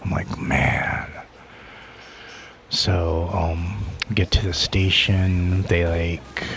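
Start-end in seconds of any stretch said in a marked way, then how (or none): 0.4-1.1 dismay. Retold or imitated. Acted dismayed reaction to threat.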